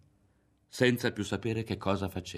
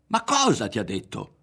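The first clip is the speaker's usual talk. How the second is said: raised, agitated question; loud demand; 4 semitones higher, 8 dB louder than usual. A narrator's reading. Ario demanding what she told Berto, loudly. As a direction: demand it at full voice.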